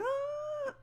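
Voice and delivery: very high, thin imitated scream; mock scream. Vlogger imitates the boy grabbed by the tree, high squeal. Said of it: "he's like".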